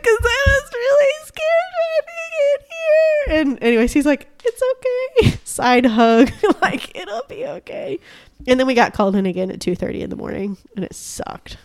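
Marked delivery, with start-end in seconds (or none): 0.0-3.4 very high, slow wailing cry; bawling. Retold or imitated. Telling Corey why she's crying after the false alarm, slow wailing cry. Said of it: "And I just start bawling again". 4.4-8.0 reassuring. Retold or imitated. Coworker comforting her with side hug.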